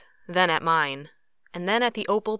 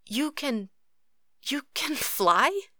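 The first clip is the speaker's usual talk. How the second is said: high, halting, amazed question; astonished; 8 semitones higher, slower than usual. A narrator's reading. Katori seeing Cruz levitate over the snow, astonished. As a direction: stammer it out slowly, rising in disbelief.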